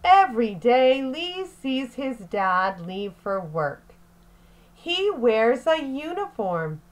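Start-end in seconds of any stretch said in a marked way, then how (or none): none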